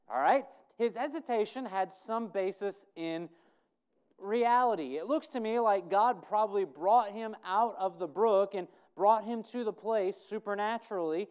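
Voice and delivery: ordinary talk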